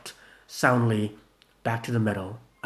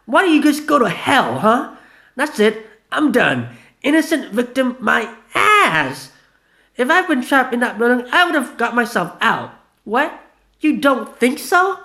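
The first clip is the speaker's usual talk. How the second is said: much higher, louder furious shout; yelling; 13 semitones higher, 9 dB louder than usual. Acted. Vanilla yelling at Gina, furious shout. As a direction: scream it at him. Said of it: "Vanilla yelled".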